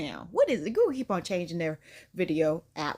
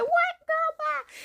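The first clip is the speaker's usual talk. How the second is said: squeaky high, put-on sassy girl voice; mocking the stories in a high voice; 3 dB louder, much slower than usual. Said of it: "These stories are like".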